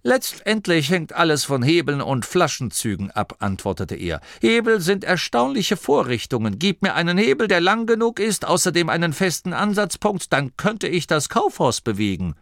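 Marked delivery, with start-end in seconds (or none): 0.0-3.4 put-on higher-pitched old tinkerer; Dorcas, old inventor. A narrator's reading. 4.4-12.4 put-on high-pitched, enthusiastic old tinkerer; Dorcas, old inventor. A narrator's reading.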